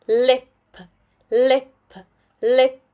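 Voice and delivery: "lip" with a short "ih". Shown as a demonstration. Teacher blends a C V C word, short I. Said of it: "the short I sound".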